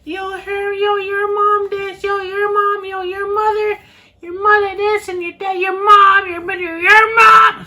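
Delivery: high frantic babble, imitating the panicked guests; shrill frantic relative. Imitating panicked voices relaying news, high frantic babble. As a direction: squeal it way up high, all in a rush. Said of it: "all I heard back was".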